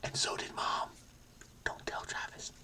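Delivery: hushed, voiceless secret; teasing whisper. Pretend. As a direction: lean in and whisper it like a sibling secret. Secret aside to his brother.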